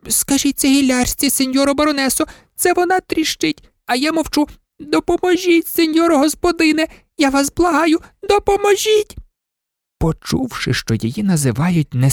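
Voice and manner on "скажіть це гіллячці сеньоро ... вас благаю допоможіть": much higher whiny maid voice; pleading old maid. Acted. Old maid begs the baroness for help, high. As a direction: beg her mistress for help, high.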